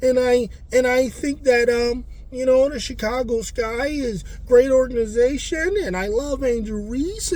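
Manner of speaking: high-pitched imitation of a sweet little girl; imitating sweet young player. Player telling interviewers she's doing her best for the team, high sweet imitation. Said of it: "when she's talking".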